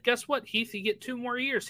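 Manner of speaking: ordinary talk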